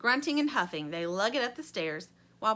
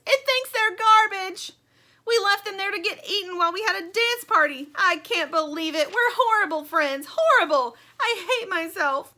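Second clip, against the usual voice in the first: hammed-up anguished moan; moaning Stingray; 11 semitones higher, 10 dB louder than usual. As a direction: wail with guilt. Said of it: "moans Stingray".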